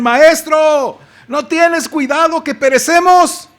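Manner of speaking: high, slow, pleading cry; desperate plea. Acted. Disciples waking the master in the storm, high desperate plea. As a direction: beg the master to wake.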